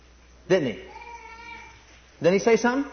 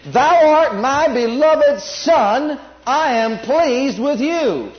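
very slow, louder, higher booming proclamation; booming voice of God; 7 semitones higher, 5 dB louder, much slower than usual. Retold or imitated. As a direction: thunder it out from heaven. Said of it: "that's a loud voice".

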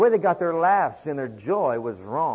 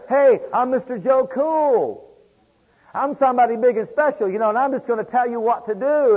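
mock boastful big shot; 9 semitones higher, 7 dB louder than usual. Mocking a proud churchgoer.

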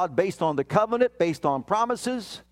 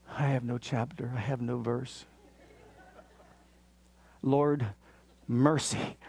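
murmured plea, much softer, half to himself; hushed prayer; 7 semitones lower, 6 dB quieter than usual. Retold or imitated. Father at the game quietly begging the Lord for mercy, hushed. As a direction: pray it under your breath, much softer. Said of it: "and I'm like".

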